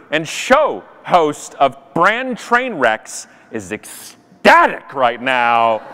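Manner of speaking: usual speaking voice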